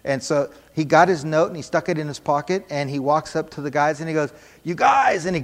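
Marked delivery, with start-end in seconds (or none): 4.6-5.3 high, loud call to the group; excited announcement. Retold or imitated. Man calling the guys at the soup kitchen, excited announcement. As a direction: call out to rally everyone.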